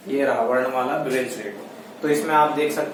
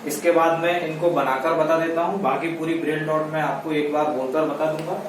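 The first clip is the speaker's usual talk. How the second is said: ordinary delivery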